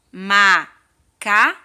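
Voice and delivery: teacher rebuilding Macaco; slow whole word. Shown as a demonstration. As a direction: blend macaco back together slowly. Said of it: "Três sílabas".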